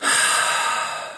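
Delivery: long voiceless exhale imitating gas; airy gas sound. Shown as a demonstration. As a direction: let out a sustained hiss of breath like gas. Breath sound illustrating a gas.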